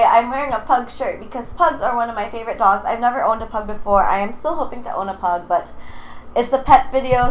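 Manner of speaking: ordinary delivery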